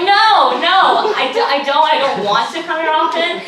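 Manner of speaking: high, protesting mock outburst; emphatic refusal. Hospital patient rejecting a stranger's pickup line, emphatic refusal. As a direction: protest as if appalled.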